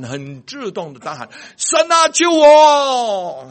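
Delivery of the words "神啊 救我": a panicked yell for rescue; desperate shout. Retold or imitated. Driver in a skidding car shouting to God for help. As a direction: cry out to God at full volume, high and loud. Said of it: "我就大喊".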